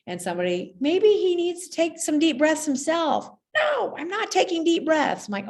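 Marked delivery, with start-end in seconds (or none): 0.8-3.3 put-on high, louder little-kid voice; kid's suggestion. Classmate offering a helpful idea, high childlike voice. 3.5-5.3 put-on high, louder pouty kid refusal; stubborn child. Upset boy refusing to breathe, pouty high voice.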